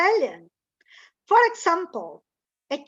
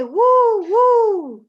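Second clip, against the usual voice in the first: high, loud woo hoot; owl hoot; 7 semitones higher, 9 dB louder than usual. Shown as a demonstration. Teacher hooting loud like an owl.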